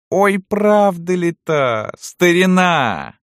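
very high, drawn-out doubting croon; mistress's mock disbelief. A narrator's reading. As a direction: draw it out high, toying with the old servant. (барыня издевательски тянет, высоким голосом)

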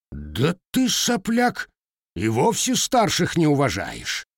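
flaring up, voice jumping high on the insult; enraged. A narrator's reading. Older man flares up at the insolent lad, enraged. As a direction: spit out the insult in fury. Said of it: "взъярился Лукьян".

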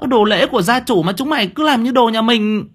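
high, loud scolding of her brothers. Acted. Thơm scolding her three brothers, high and loud. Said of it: "Lông mày của cô cau tít".

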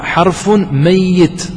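حرف ميت spoken very slowly, each word drawn out; slow emphasis. Shown as a demonstration. Teacher slowly stresses the scholars' term. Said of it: "يقول العلماء".

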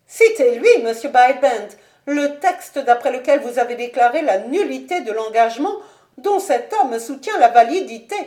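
loud, high, commanding noblewoman; imperious mother. Acted. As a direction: order the minister forcefully to produce proof. Lady Ashton orders the minister. (mère impérieuse, voix forte et aiguë)

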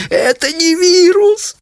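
very high, drawn-out, helpless squeal; barely able to speak for laughing. A narrator's reading. Daughter correcting parents through laughter, squealing. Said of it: "от смеха едва могла говорить".

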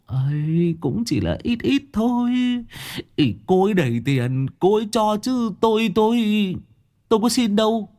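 high, sheepish mumbled excuse; sheepish Long. Acted. Long, caught taking money, sheepishly high-voiced. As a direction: squirm out of it, pitched high. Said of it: "Long đầu búa lúc này trùng xuống".

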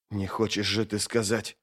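dawning alarm kept under the breath; hushed disbelief. Acted. Mamun's calm evaporates in dawning alarm.

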